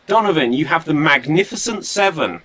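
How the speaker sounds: normal talking voice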